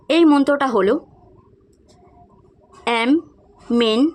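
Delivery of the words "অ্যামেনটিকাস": very slow, each syllable cut apart; staccato. Shown as a demonstration. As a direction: say it syllable by syllable, slowly. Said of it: "খুবই কেটে কেটে উচ্চারণ করছি".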